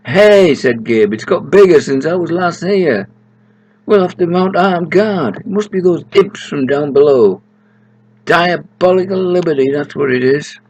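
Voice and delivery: high and loud indignation; rally them to guard the crop; high outraged Gabe; excited outrage. Acted. Gabe indignant about thieves.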